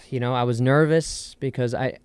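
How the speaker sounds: ordinary talk, nothing marked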